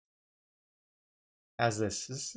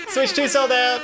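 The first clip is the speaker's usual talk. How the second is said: mock wail; 12 semitones higher, 4 dB louder than usual. Shown as a demonstration. There I am crying as well.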